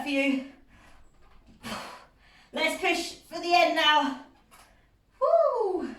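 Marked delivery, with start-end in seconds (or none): 5.2-5.9 high excited whoop. Real, not acted. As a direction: cheer the class on. Cheering to push the end.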